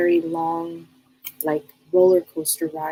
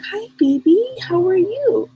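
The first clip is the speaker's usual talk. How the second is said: put-on sweet greeting to a child; 13 semitones higher than usual. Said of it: "And I'm like".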